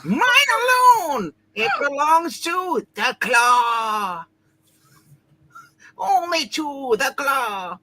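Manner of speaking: booming villain gloating; hammy supervillain proclaiming. Acted. Villain Claw claims vibranium.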